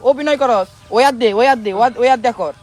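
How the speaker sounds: loud, high-pitched teacher's telling-off; angry scolding. Acted. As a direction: bark impatient orders at a pupil. Teacher scolds pupil over hand.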